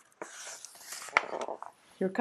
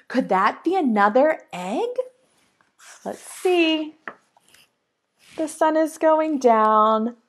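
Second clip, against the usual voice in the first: usual speaking voice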